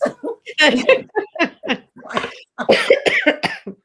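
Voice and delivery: big high burst of laughter; loud high laugh. Real, not acted. Host cracking up at her guest's reply, big high laugh. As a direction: let a delighted laugh ring out.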